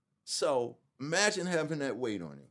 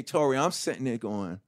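ordinary delivery

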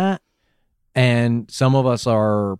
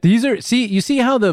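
excited; 11 semitones higher than usual. Real, not acted. Coaching email tone, pitch jumps.

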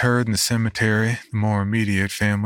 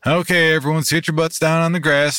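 higher, louder call to a group; rally the kids, raised and higher; 8 semitones higher, 4 dB louder than usual. Acted. Uncle gathering the kids for a story, calling higher and louder.

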